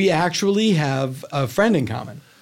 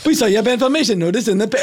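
same flat delivery every take, sped up; identical robotic line reading; 6 semitones higher, 3 dB louder, faster than usual. Retold or imitated. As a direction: rattle it off exactly the same each time. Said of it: "freakishly the same thing every time".

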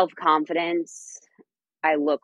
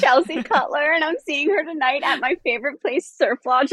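much higher, giddy excited voice; freaking out; 12 semitones higher than usual. Real, not acted. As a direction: gush like a thrilled fan. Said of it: "I'm freaking out".

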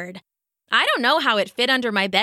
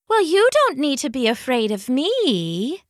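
wispy and long-drawn; 3 semitones higher than usual. Acted. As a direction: let it float out wispy. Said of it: "It came out all wispy and long".

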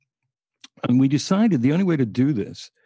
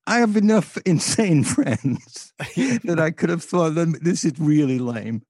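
laughing while talking; 7 semitones higher, slower than usual. Real, not acted. Laughing while telling the story.

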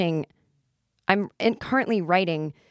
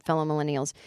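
ordinary talk, nothing marked

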